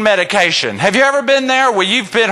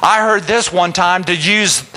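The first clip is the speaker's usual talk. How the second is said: ordinary talk, nothing marked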